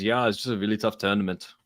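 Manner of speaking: lightly put-on Italian-German accent. Commentator predicting the winner's speech, Italian-German accent. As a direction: imitate a player in a mixed Italian-German accent. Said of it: "that was my Italian German accent".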